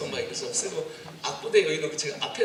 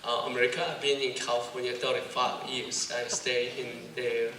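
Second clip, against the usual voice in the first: slow, casual American English; laid-back American accent, drawn out; much slower than usual. Shown as a demonstration. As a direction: pose as a Korean-American, unhurried. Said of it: "미국 사람 같다".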